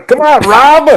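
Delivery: high, drawn-out pleading cheer; playful goading. Pretend. Host goads co-host to stay up for the game, high playful call. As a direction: egg him on with a stretched, high call.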